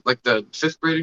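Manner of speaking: ordinary delivery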